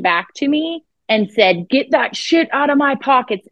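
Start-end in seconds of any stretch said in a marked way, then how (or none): 1.7-3.5 mild imitation of a demon's voice, a bit higher; a light hint of a growling demon; demonic voice. Retelling the possessed woman snarling at the exorcist, lightly imitated demon voice. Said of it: "in the worst electronic demonic voice".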